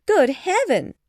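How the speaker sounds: very high, loud outburst; flustered mother's cry of amazement; excited exclamation. A narrator's reading. Mother hears of the engagement, overexcited high cry. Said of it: "she exclaimed".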